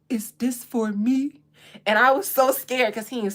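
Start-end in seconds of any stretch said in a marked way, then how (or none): 0.1-1.4 put-on slow, lightly deepened little boy; deep kid voice. Nephew opening his gift, lightly deepened voice. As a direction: drop the voice for the nephew. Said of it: "imitate them and I drop my voice".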